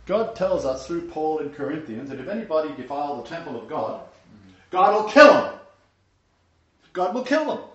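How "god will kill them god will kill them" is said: loud, high "kill" hammered home; forceful warning. Real, not acted. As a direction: drive the word "kill" in, louder. Preacher repeats warning.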